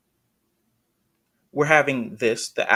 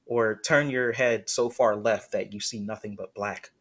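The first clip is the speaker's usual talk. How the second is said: ordinary talk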